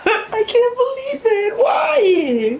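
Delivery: high laughing-crying wail; high-pitched anguished plea; tearful disbelief. Real, not acted. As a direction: wail it in grief, half laughing. Reacting to character's death.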